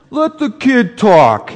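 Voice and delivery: loud Brooklyn Santa; a hint of a Brooklyn Santa; Brooklyn accent. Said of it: "a Santa with kind of a Brooklyn accent".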